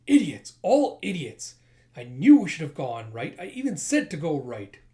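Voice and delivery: loud, hammed-up tantrum; furious berating. Leader berating his henchmen as idiots, loud exasperation. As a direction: rant at incompetent underlings.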